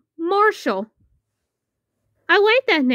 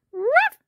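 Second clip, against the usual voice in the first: high, happy yapping bark; high puppy woof; 10 semitones higher than usual. Acted. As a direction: bark like a delighted little pup. Puppy barks after adoption.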